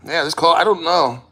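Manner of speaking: normal talking voice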